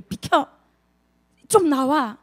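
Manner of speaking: short, forceful command to step aside; brusque order. Acted. Imagined Lord seizing the helm, brusque and forceful. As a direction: push someone out of the way with words.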